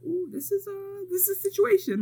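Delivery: high mock-scandalized reaction; Goldie. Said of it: "And Goldie is like".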